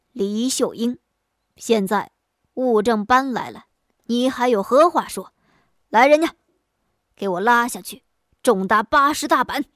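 magistrate thundering the sentence, loud; loud angry command. Acted. Magistrate sentences the woman to eighty blows, shouting loud. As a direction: build to a shouted order for the beating. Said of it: "大声喝道".

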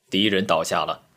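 plain reading voice

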